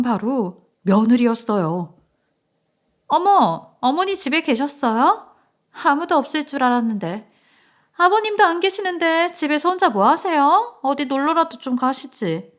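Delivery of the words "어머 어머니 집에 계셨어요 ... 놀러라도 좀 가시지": high, pointed younger voice; surprised daughter-in-law. Acted. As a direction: act surprised to find mother-in-law home. Daughter-in-law surprised mother-in-law is home. (놀란 척 높게 말하는 며느리)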